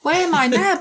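Byron speaking aloud. A narrator's reading. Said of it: "Byron said out loud".

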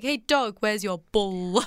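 bull drawn out slowly on a heavy L; slow down and linger on the L of bull; emphasis on L. Retold or imitated. Mocking Jack's dad calling the dog, over-emphasised L. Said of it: "put so much emphasis on the L".

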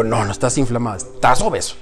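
low, quiet, flat-out verdict; blunt correction. Retold or imitated. Telling the older man he is obese, low and blunt.

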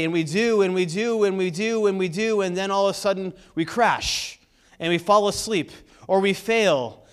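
fervent pulpit preaching, pushing upward. Acted. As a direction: preach it out with full force. Preaching on human weakness.

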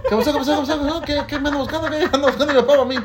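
put-on high, rapid-fire child voice; nosy little kid. Little boy butting in on his dad's visitors, high kid voice. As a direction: pester with eager questions, pitched well up. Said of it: "salí con él así como un chipito ahí".

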